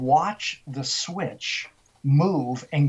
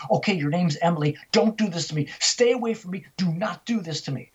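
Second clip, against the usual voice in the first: raised pitch, urgent retold plea; firm warding-off; 3 semitones higher, faster than usual. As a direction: push it away, higher and insistent. Retold woman warding off spirit.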